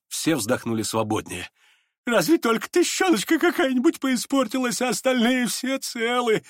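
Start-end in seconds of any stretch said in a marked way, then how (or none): 2.1-6.4 tender, very high piping voice; Лебедев. A narrator's reading. Лебедев finds the money whole, high tender piping. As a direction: coo it high, drawing out the end. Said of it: "с умилением выговорил Лебедев".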